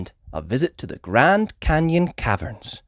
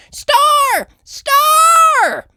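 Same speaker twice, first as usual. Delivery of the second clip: very high, loud excited shouting; 10 dB louder than usual. A narrator's reading. George spotting Hollywood stars, very high shouting. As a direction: yell it out pointing. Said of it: "he shouted".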